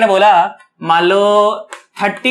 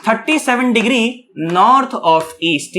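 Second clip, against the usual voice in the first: slow and deliberate; much slower than usual. Shown as a demonstration. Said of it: "इस direction को हम लोग कहेंगे क्या?".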